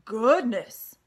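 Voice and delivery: loud gasping exclamation; amazed pig. Acted. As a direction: blurt it out in wonder. Wilbur amazed.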